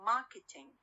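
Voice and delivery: "Marketing" with the stress on MAR; stress on stem. Shown as a demonstration. Teacher adds -ing to market, stress kept on MAR in "Marketing". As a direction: hit MAR, leave -ing light in "Marketing". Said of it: "On the, the stem and not".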